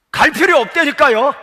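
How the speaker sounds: high, loud protest; acted insistent refusal. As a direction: refuse loudly as an exasperated sister. 마르다가 무덤 가기를 거부. (버럭 우기며 거절하는 연기)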